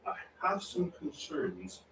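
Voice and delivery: slow, hushed royal reproof, imitated; Queen Elizabeth. Repeating the Queen's famous line, quiet and slow. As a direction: softly draw out a monarch's mild scolding. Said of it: "Kind of scolded the nation".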